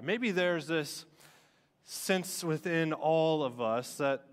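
ordinary talk, nothing marked

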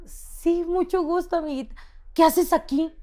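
a fake friendly chirp; nervously sweet greeting to a stranger; awkward sweet politeness. Awkward polite reply to fan.

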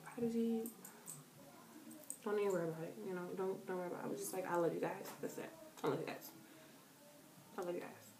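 very soft, low murmur; subdued reassurance. Retold or imitated. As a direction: retell it small and low, almost to yourself. Retells their own tearful, subdued reply.

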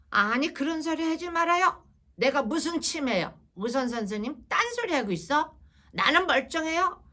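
acted huffy outburst; angry elderly patient. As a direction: brush the doctor off, offended and insistent. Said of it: "막 이렇게 화를 막 내시는 거예요".

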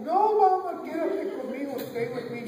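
put-on high, loud child's plea; pleading child. Child begging mother to stay, high pleading. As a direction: beg mama like a frightened little kid.

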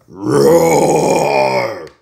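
long, very loud mock-angry roar; hammed-up monster roar; pretend roar. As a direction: roar back defiantly, as loud as you can. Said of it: "Don't roar".

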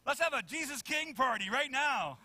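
high, loud party invitation; rally everyone with acted-up excitement; excited cheer. Eager believer calling for a Jesus King party, acted excitement.

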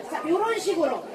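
ordinary talk

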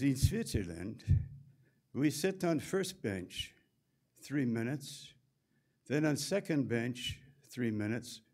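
put-on a mild Swiss accent, counting off the sauna routine. Swiss technician explaining sauna rules, in a light Swiss accent. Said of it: "fit my Swiss accent".

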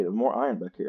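ordinary talk

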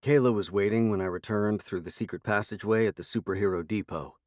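plain reading voice

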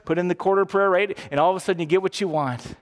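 ordinary talk, nothing marked

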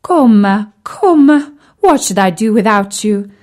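loud, crying out the name fondly; doting mistress. A narrator's reading. The lady to her cat Korma, loud and doting. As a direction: call the cat's name with loud adoring longing. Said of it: "never tired of saying".

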